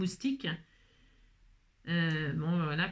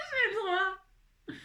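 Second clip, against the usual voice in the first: mock whine; 10 semitones higher, much slower than usual. Mock whine after laughing.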